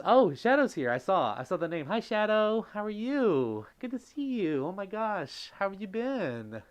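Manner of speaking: light up as a friend walks in; thrilled to see a friend in chat; excited greeting. Real, not acted.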